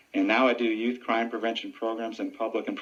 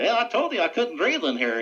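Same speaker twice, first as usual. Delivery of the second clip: high, loud puppet voice; squeaky dummy complaining from the box; 11 semitones higher, 4 dB louder than usual. Acted. Ventriloquist dummy speaks from box.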